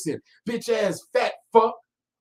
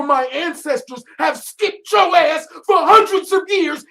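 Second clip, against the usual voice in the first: high, loud angry yelling; real furious rant; furious shouting; 7 semitones higher, 4 dB louder than usual. Real, not acted. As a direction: tear into him, full volume. Furious rant about ancestors.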